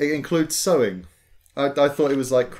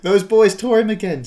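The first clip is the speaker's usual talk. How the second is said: put-on high, loud child voice; young dwarf girl; little girl voice; 8 semitones higher, 7 dB louder than usual. Penny voiced sadly.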